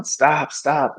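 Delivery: retelling a man yelling at the ambulances; retold yelling. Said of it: "The guy's screaming and yelling".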